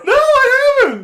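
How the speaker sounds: very high, loud, drawn-out laughing cry; shrieking with laughter. Real, not acted. Telling the co-host it was new, shrieking with laughter. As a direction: squeal it slowly through laughter. Cracking up at the comic.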